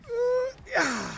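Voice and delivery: very high squealed cheer; excited whoop. Real, not acted. Gamer celebrating a play, excited whoop.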